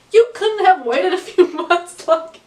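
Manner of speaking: half-laughing at the bad luck; laughing complaint. Real, not acted. Senior laughing at her bad luck, exasperated.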